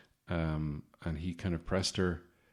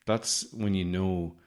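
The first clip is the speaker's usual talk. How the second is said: ordinary delivery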